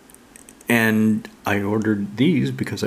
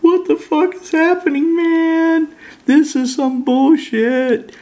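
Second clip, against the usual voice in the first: much higher, louder squeaky outraged critter; bewildered fox voice; 5 dB louder, slower than usual. Pretend. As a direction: voice the fox's baffled outrage, stretching the end slowly. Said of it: "It's like".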